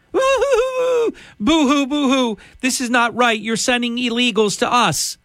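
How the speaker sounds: high, whiny mock sobbing; hammed-up boo-hoo crying mayor; fake crying. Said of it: "listening to this Washington, D C mayor".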